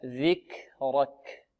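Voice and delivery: "ذكرك" slow and soft with audible breath on kaf; breathy kaf demo. Shown as a demonstration. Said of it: "هكذا". (نطق "ذكرك" بهمس الكاف وجريان النفس)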